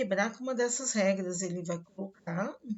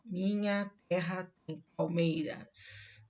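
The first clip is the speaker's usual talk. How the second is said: slow, each syllable of Minha terra tem palmeiras set apart; scanning syllables; slower than usual. Shown as a demonstration. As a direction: tap out the seven syllables as you say it. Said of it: "em vez de cinco sílabas, ele vai usar sete".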